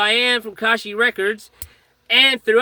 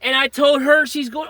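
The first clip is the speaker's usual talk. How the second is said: ordinary talk, nothing marked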